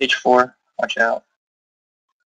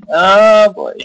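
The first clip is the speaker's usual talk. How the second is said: louder dismayed exclamation; 7 semitones higher, 5 dB louder than usual. Real, not acted. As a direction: groan at the loss. Bishop just taken.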